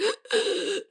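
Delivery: high, desperate gasp for air; imitated gasping. Child panicking in mom's car, gasping for air. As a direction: pull in breath as if airways are closing. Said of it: "like, gasping for air".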